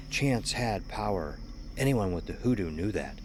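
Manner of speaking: ordinary delivery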